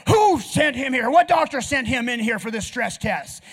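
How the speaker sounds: acted bossy outburst; brusque doctor. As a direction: imitate an outraged cardiologist storming in. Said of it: "he's got that feel".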